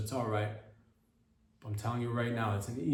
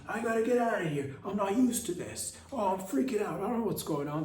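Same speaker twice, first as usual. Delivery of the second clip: high, frantic mock freak-out; mock panic; 8 semitones higher than usual. Mocking panicked office workers, high frantic voice. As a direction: squeal it like a panicking coworker.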